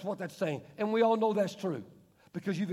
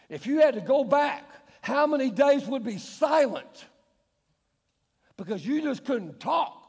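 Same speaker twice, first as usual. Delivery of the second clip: preaching with force; 6 semitones higher, 6 dB louder than usual. Real, not acted. Preacher challenging the congregation.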